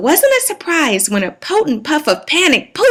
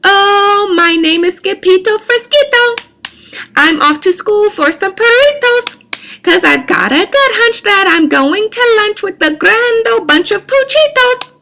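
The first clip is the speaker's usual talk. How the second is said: put-on much higher, louder Spanish-accented swagger; 10 semitones higher, 3 dB louder than usual. A narrator's reading. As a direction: announce yourself like a proud little Spanish-accented hero. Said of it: "using his very best Spanish accent".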